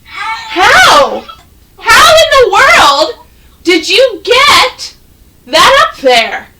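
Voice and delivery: high, loud amazed question; baffled, almost yelping it; loud astonished how. Real, not acted. Teacher amazed at screen.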